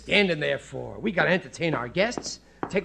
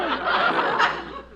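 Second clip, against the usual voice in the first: nervous laugh; 13 semitones higher than usual. Acted. Realizing the wrong book.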